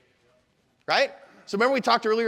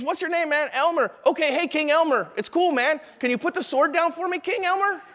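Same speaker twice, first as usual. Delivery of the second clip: higher, mock-casual cop voice; imitated officer coaxing King Elmer, pitched up; easygoing officer; 7 semitones higher than usual. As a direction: play it cool, humoring the man. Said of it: "He goes".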